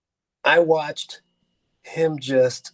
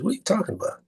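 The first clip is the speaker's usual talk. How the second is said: high, softer puzzled question; 9 semitones higher, 5 dB quieter, much faster than usual. Retold or imitated. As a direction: act out a baffled friend, pitched up. Retold puzzled friend in dialogue.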